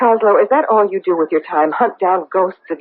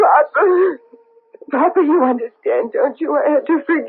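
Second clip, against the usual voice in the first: high, tearful begging of Papa; crying plea; 5 semitones higher than usual. Acted. Daughter in a nightmare, sobbing to her dead father. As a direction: weep and beg him to understand.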